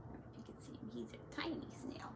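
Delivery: hushed aside, breathy whisper; fast whispered aside. Real, not acted. Aside to child while reading.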